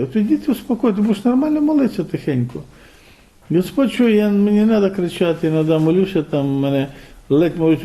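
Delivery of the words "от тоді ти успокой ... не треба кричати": raised pitch, earnest preaching. Acted. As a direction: press the point higher, pleading.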